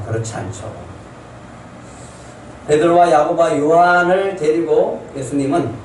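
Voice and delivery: ordinary delivery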